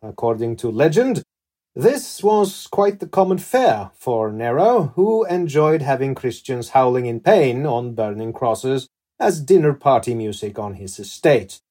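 measured, theatrical storytelling with emphatic peaks; dramatic story narration. Acted. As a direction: narrate it like a dark historical tale. Host reads scripted story to guest.

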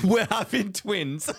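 slow words broken by real laughter; laughing with joy. Real, not acted. Telling his friend about the twins, laughing with joy. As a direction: laugh through the big news.

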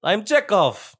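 high, loud mock Russian accent; laughing Russian-accent impression; hammed-up Russian introduction; Chekhov. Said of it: "you can do the Russian".

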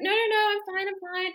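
high, loud, hasty brush-off; retold flustered denial. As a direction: imitate a nervous man insisting all is well. Retells nervous fiancé brushing off concern.

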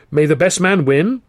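grudging, teeth-clenched politeness; through gritted teeth. Acted. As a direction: spit out good wishes you don't mean. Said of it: "through gritted teeth".

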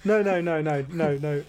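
ordinary talk, nothing marked

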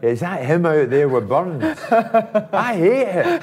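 loud, laughing mock outrage; mock angry fan. Jim laughing as he mimics fans abusing him. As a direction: ham up a furious Celtic fan. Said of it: "verbal diatribe I was getting".

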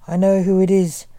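ordinary delivery

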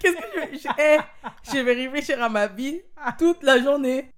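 high, loud mock outrage through laughter; comic disbelief bubbling with laughs; laughing indignation. Real, not acted. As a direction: protest it while cracking up. Imagines being deleted, laughing in mock outrage.

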